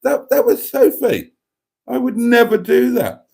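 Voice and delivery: high, loud mock-outraged denial; outraged protest. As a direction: protest your innocence, hammed up. Host protests against listener teasing.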